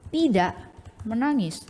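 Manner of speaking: ordinary talk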